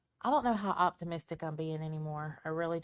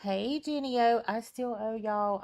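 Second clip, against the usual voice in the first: usual speaking voice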